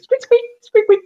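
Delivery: high squeaky-toy squeaks; squeaky toy noise. Acted. Player imitates the dog's squeaky ball, high squeaks. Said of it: "And goes".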